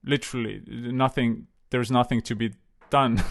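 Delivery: ordinary delivery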